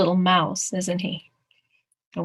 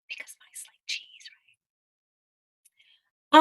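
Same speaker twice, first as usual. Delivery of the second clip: whispered aside. Real, not acted. Reader confiding in the children about mice, whispered. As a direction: whisper it like a secret.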